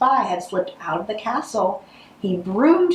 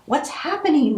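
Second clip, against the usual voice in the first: wailing; 7 semitones higher than usual. Acted. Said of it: "wailed the Pepperwills".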